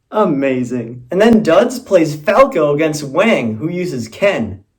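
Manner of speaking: ordinary talk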